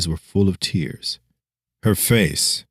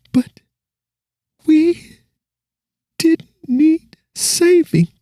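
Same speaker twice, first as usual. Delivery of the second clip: put-on much higher girl's voice, slow, starting in a whisper; fading girl's voice; 5 dB louder, slower than usual. Dying girl comforting the hero, high and fading. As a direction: let the dying girl's voice slip away. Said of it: "she said in a fading voice".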